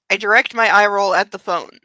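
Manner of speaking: usual speaking voice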